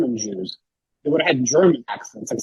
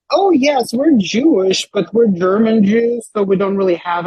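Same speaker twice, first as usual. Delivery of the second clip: much higher, louder, singsong exposition voice; mock stilted dialogue; 7 semitones higher, 6 dB louder, slower than usual. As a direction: overplay a clunky line announcing who you are. Mocking awkward exposition dialogue.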